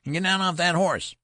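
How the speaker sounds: loud, very high furious order; ferocious. A narrator's reading. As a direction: bark it out in a ferocious treble. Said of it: "in a ferocious voice".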